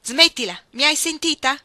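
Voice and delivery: loud, high, forceful order; sharp angry command. Acted. Ruth to screaming Iggy before slapping her, angry command. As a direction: stop her outburst cold.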